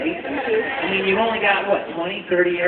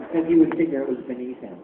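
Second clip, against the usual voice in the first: ordinary talk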